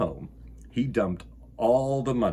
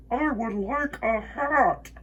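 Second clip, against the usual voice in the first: echo; 10 semitones higher, slower than usual. Acted. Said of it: "his own voice echoed off".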